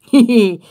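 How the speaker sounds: brief laugh to oneself; short chuckle. Real, not acted. Amused after explaining the word.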